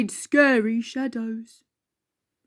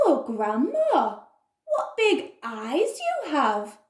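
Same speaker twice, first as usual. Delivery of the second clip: put-on wondering girl; 3 semitones higher, 5 dB quieter than usual. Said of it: "little girl".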